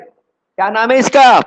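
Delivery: loud quiz question. Acted. Teacher quizzing class loudly.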